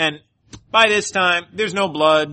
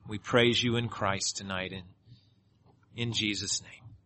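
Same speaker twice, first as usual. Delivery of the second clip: closing prayer; 4 semitones lower than usual. Acted. Pastor closes in prayer.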